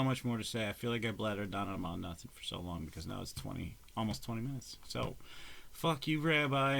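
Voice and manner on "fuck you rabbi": slow, louder teasing jab at Rabbi; slow, louder, joking curse at a friend; mock insult. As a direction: drag it out, a playful taunt.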